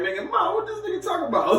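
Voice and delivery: very high, laughing disbelief; mock baffled complaint. Rival at his mom's house asking her, baffled high voice. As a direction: call to your mother high and baffled, half laughing.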